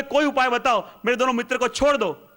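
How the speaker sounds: high-pitched urgent plea; acted pleading. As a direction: beg the demon, in storytelling style.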